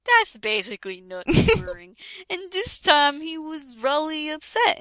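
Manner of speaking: ordinary talk, nothing marked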